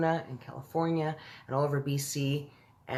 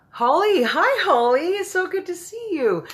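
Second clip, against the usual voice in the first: high and loud delighted hello; light up and greet her high; excited high greeting; 8 dB louder than usual. Real, not acted. Welcoming Holly to the stream, high and delighted. Greeting a newly joined viewer.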